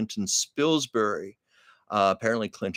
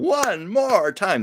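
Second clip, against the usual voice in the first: "One more time" drawn out slow and pitched higher, like a stage director; showbiz catchphrase; 11 semitones higher, 3 dB louder, much slower than usual. Shown as a demonstration. Performing the old show business saying with a slow, high theatrical call. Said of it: "like the old show business saying".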